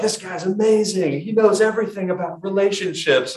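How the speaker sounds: mock gushing fan. Preacher mocks churchgoers praising a teen author, gushing. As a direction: gush like a starstruck follower. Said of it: "And people were like".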